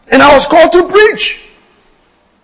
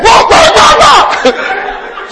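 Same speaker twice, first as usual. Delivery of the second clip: mock wailing; 12 semitones higher, much slower than usual. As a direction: wail it in a hammed-up, laughing way. Imitating the family's wailing.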